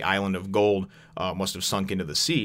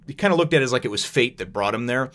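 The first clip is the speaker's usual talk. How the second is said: ordinary delivery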